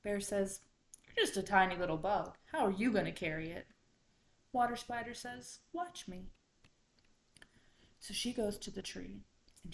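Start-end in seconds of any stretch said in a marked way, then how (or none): 1.1-3.7 loud, fast challenge; dismissive bear. A narrator's reading. Bear doubting tiny Water Spider, loud quick dismissal. 5.7-6.3 high little spider voice; confident little spider. A narrator's reading. Spider to the doubting bear, high confident reply.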